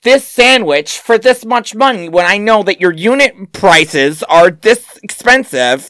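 high-pitched, indignant price rant; complaining customer. Acted. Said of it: "come through and complain about the prices".

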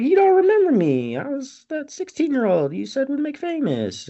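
high-pitched mock girl's voice; indignant girl. Imitating girl reminding him who she is, high and indignant. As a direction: pitch it way up, opening loud.

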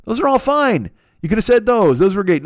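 worked-up rant; exasperated rant. Real, not acted. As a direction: plead with them in disbelief. Ranting about the word chip.

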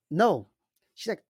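ordinary delivery

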